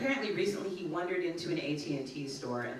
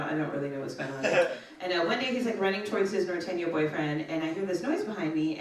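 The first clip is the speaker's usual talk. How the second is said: ordinary delivery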